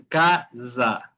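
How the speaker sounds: "Casa" in two syllables, ca-za; audible buzzing S. Shown as a demonstration. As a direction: let the Z buzz in "Casa". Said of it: "que se escuche el sonido". ("Casa" marcando la S como zumbido)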